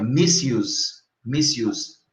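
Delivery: misuse said mis-YOOZ, with a clear s and a y glide; correct English pronunciation. Shown as a demonstration. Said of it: "em inglês se fala use". (misuse pronunciado corretamente, mis-iuz)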